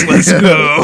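loud laughing shout; hype shout. Real, not acted. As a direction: yell it with a laugh.

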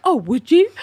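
mock delighted surprise. Richard flirting with a woman, mock delighted surprise. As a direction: feign surprise at a kind offer. Retold grateful flirting, acting coy.

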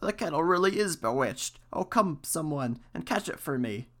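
mildly distressed plea, starting high; crying out. A narrator's reading. Priest calls someone to catch kettle, crying out. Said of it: "he cried".

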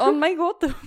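laughing out the words. Real, not acted. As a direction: laugh it out loud. Laughing at the corpse joke.

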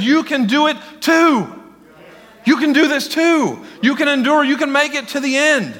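family members urging you on; encouraging. Acted. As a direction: lift them up with every line.

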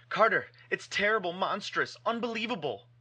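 high, frantic cry of horror; frenzied. Acted. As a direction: shriek it in panic. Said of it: "the frenzied tones again".

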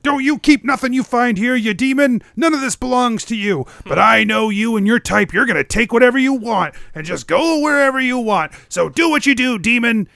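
high-pitched scolding hermit character; zrzędliwy Vrath. Acted.

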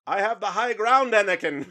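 hammed-up movie line; Obi-Wan quote. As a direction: declare it to squabbling cats. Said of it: "my cats were fighting".

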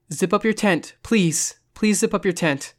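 tense, insistent imploring; urgent pleading. A narrator's reading. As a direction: beg a friend to hurry. Begging friend to close tent.

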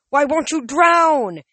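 high, loud, slow wail. A narrator's reading. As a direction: wail in frustration. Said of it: "he wailed".

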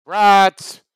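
high, loud hawker's cry of brats; vendor's call. Acted. Sausage vendor shouting to passing crowd, loud hawker's cry. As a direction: bellow it across the crowd like a street vendor. Vendor calling out his wares.

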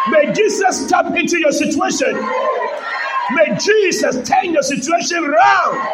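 rising exhortation to a held-out finish; preached blessing. Acted. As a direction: declare a blessing over the church. Prays over congregation at full force.

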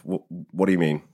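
ordinary talk, nothing marked

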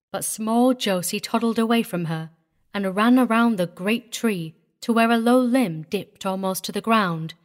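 ordinary delivery

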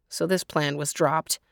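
ordinary delivery

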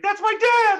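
very high, loud cry of a daughter; mock daughter crying out to stop a fight; alarmed daughter. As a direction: shriek it like a scared kid.

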